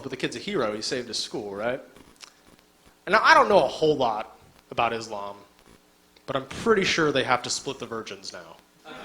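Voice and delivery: ordinary talk, nothing marked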